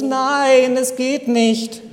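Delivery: put-on sobbing child. Boy clinging to branch refuses father, high sobbing. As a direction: wail it like a frightened child, then slow down. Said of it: "der Kleine schluchzt".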